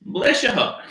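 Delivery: high-pitched fake Southern lilt; Southern accent. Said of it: "I can't do accents".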